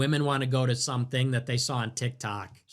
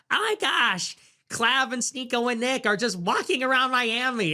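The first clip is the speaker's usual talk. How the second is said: much higher, gushing valley girl imitation; mock girly voice; 8 semitones higher than usual. Streamer mocking Brett Cooper's excited reaction, high girly voice. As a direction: mimic her excited gushing. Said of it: "and she's like".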